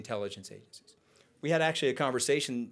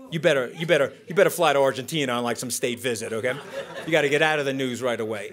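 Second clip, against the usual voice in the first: loud, fast bossy boss voice, with a laugh; the president's orders; 6 dB louder than usual. Retold or imitated. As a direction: bark it out like an impatient boss, quick and loud. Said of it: "If he says two things to you".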